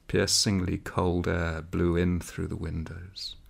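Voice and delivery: ordinary delivery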